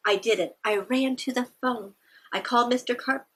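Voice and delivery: plain reading voice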